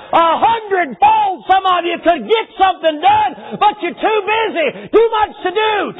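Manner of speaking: high, loud, slow rebuking shout; fervent preaching. Acted. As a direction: hammer the congregation with it. Preaching at full force.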